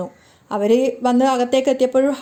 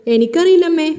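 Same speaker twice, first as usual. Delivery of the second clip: higher, louder mock-innocent reply; teasing; 4 semitones higher, 5 dB louder than usual. A narrator's reading. Daughter teasing her worried mother, mock-innocent and louder. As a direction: tease her, playing dumb and sing-song. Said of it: "Revathi കളിയാക്കുകയാണ്".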